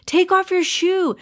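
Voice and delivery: high, urgent yell without much extra volume; acted yell. A narrator's reading. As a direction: yell urgent orders at a friend in danger. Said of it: "and yelled".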